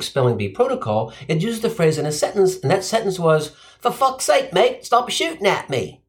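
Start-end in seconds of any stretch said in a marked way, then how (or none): 3.8-4.5 higher-pitched mock Australian accent; Aussie accent. Example sentence read out in a mock Aussie accent. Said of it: "must be Aussie". 4.5-6.0 mock Australian plea, pitched up; Aussie accent. Aussie man asking cops to stop, in mock Aussie accent. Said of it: "must be Aussie".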